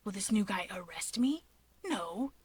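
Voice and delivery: hushed, breathy worried whisper; whispered Shigaraki. A narrator's reading. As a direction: whisper it, anxious and clingy.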